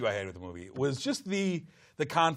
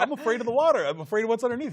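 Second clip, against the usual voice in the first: higher, louder imitation of a worrier; hammed-up fretting in a raised pitch; mock fearful; 8 semitones higher, 4 dB louder, faster than usual. Imitating someone afraid of the water, mock fearful. Voices a scared crewman in retelling.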